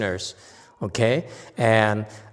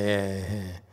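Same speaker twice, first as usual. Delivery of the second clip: low, soft mock chuckle; half-hearted imitated laugh; mock laughter; 5 semitones lower, 7 dB quieter than usual. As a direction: imitate sneering specialists with a quiet heh-heh. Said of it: "would laugh at us and say".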